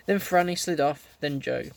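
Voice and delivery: ordinary delivery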